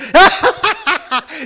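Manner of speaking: high, loud monster cackle; evil cackle. Acted. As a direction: let the cackle ring out. Villain laughs again.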